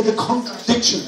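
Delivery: normal talking voice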